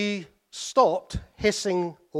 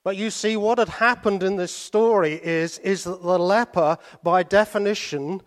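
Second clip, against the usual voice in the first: louder, raised preaching voice; preaching at full force; 4 semitones higher, 6 dB louder than usual. Acted. Preacher pressing the leper story on the congregation, forceful. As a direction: drive the point home to the congregation. Preacher drives home the point.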